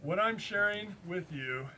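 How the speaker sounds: normal talking voice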